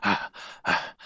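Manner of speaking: two breathy, voiceless huffs; puffed, airy panting noises; huffing. Retold or imitated. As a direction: act out being out of breath. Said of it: "I was huffing like".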